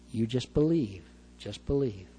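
calm a frightened father; quiet tender comfort; gentle reassurance. Retold or imitated. Jesus reassuring Jairus.

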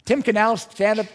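normal talking voice

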